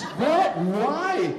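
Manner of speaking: high, indignant disbelief; shocked fan. Retold or imitated. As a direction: gasp at the insult to your hero.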